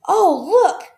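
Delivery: high, loud delighted cry; children spotting a new toy; excited children. A narrator's reading. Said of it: "they cried".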